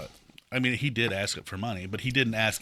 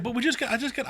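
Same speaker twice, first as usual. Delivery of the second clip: high, put-on woman's protest; pleading lady; 12 semitones higher, faster than usual. Imitating the lady arguing after refusal, pleading.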